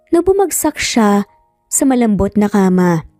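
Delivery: ordinary delivery, nothing marked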